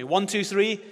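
ordinary talk, nothing marked

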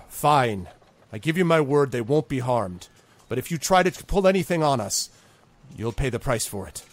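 angry grudging promise; grudging threat. Acted. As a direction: give in, then threaten. Villain grudgingly agrees, warns.

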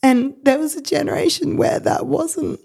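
real tears. Real, not acted. Mum moved by the friend proud of ADHD, crying. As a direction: voice breaking high with real crying. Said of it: "makes me emotional".

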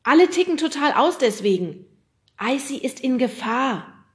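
loud, high, urgent warning; alarmed call. A narrator's reading. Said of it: "rief Fred dazwischen". (laut und aufgeregt gerufen)

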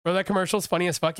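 normal talking voice

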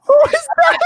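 very high, loud squeal of disbelief; shrieking amusement. Real, not acted. Bandmate reacting to teacher impression, shrieking amusement. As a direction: shriek it through laughter.

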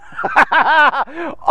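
hearty laugh after the mock panic. Real, not acted. Preacher amused by his fleeing-king act, laughing.

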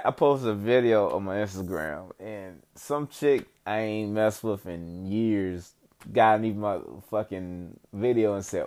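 ordinary talk

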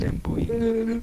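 squeaky high flamboyant; high mocking voice. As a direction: say it high and campy.

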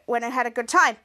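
usual speaking voice

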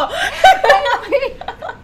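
big, high burst of laughter; loud high laugh. Real, not acted. Teased about her Cuban ex, she bursts out laughing.

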